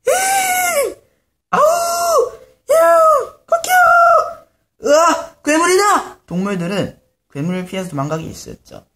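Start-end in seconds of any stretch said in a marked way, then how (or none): 0.1-4.9 very high, drawn-out screaming cries; bray, howl and crow at full volume; animals' big shout. Acted. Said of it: "큰 소리를 질러내기 시작했지". 4.9-6.2 high, loud panicked cry; frightened scream. Acted. Monsters flee screaming.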